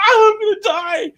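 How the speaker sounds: father imitating himself after the pepper, hammed-up agony; mock dying. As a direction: ham up your own suffering. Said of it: "I'm sitting there like".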